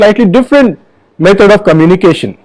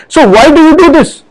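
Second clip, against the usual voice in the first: higher, lively question landing on DO; question with shifted emphasis; 8 semitones higher than usual. Shown as a demonstration. Speaker showing viewers how one question varies, emphatic question. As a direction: ask it raised in pitch, DO falling away. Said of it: "I can ask the same question".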